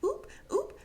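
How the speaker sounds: very high little yelps; stumbling oops. Retold or imitated. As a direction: imitate someone tottering off balance. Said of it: "she's stumbling and everything".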